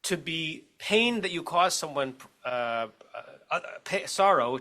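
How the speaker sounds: ordinary delivery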